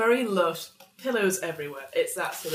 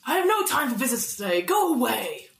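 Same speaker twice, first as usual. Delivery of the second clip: higher-pitched, insistent dismissal; Grandmaster's booming voice; 5 semitones higher than usual. Acted. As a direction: repel the visitors imperiously. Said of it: "a big booming voice says".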